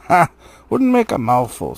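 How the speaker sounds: loud mocking troll scoff; a hint of a scornful troll. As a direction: laugh him off as too small.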